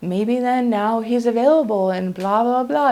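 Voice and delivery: imagine being thrilled, dismissively; hammed-up hopeful musing; mock ecstatic. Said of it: "You would think I would be ecstatic".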